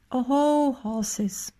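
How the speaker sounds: a soothing call of "Oho, Horses"; calm the animals with a long, gentle call; the worker gently calls to the shadowy herd; calling soothingly. A narrator's reading. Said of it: "rief er beruhigend".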